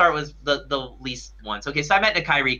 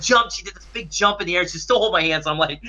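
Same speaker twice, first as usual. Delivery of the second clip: normal talking voice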